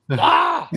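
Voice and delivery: shrill startled ah; high yelp. Acted. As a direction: yelp in mock shock. Mock shock after quote.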